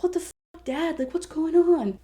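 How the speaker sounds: high-pitched, stretched-out mock bewilderment; shocked disbelief. Son finding his dad fighting, shocked. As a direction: act out an appalled demand for answers.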